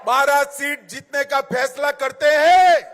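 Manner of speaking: shouted rally question; booming political rally speech. Acted. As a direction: yell it to the whole hall.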